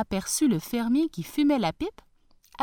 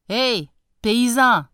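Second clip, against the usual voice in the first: loud, slow call; 3 semitones higher, 8 dB louder, slower than usual. Acted. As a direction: hail him from the carriage. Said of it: "cria-t-il".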